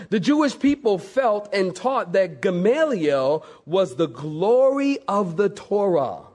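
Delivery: ordinary talk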